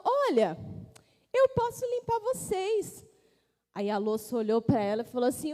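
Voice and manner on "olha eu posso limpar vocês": eager sponge offering. Acted.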